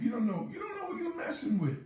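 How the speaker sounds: high, heated warning. Retold or imitated. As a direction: warn her she doesn't know who he is. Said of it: "I said".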